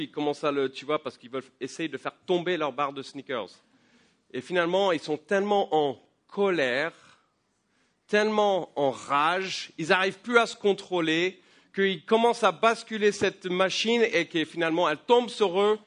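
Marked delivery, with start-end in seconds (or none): 4.3-15.8 rising, louder and higher preacher's build; preaching at full force. Acted. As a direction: drive the story to its climax from the pulpit. Preaches the vending machine story. (prédication enflammée, de plus en plus forte)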